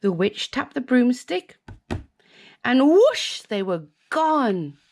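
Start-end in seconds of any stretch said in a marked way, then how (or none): 2.6-3.4 high, loud whoosh. A narrator's reading. Reader sending the broom off, high and loud. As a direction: launch the broom with it.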